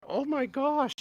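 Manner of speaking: high, loud "oh my gosh" in disbelief; stunned gasp of disbelief; shocked. Retold or imitated. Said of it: "I'm, like, sitting there going".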